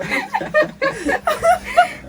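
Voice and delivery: long, high-pitched laugh; high laughing fit. Real, not acted. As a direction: crack up helplessly, high and drawn out. Laughing about the show.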